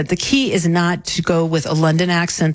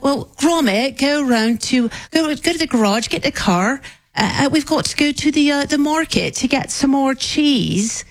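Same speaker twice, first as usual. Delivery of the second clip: high, broad Southwest England country accent; high-pitched English country accent, as if talking to Gromit; English countryside accent; 7 semitones higher than usual. Shown as a demonstration. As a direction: widen it into a rural English West Country accent. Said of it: "That would be country accent".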